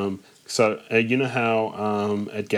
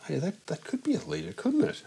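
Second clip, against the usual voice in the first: high, soft retold musing; acted hopeful wondering; 7 semitones higher, 7 dB quieter than usual. Imagining someone guessing at the bottle size, hopeful and wondering. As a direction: lift it high and light into a question.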